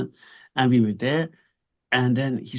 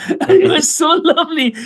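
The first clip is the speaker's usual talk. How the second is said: laughing; 6 dB louder than usual. Real, not acted. Laughing while retelling Tajik waiter.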